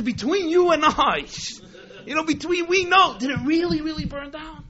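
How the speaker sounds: high-pitched, insistent private question; confidential pleading. Retold or imitated. Miser pulling his manager aside, high confidential pleading. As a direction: plead for the secret truth in a put-on voice. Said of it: "he pulls him to the side".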